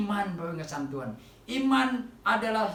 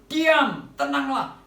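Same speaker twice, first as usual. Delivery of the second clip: loud, commanding higher shout; Jesus rebuking the wind and the lake; stern rebuke; 7 semitones higher, 8 dB louder, slower than usual. Retold or imitated. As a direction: order the storm to be still. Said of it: "Yesus menghardik angin".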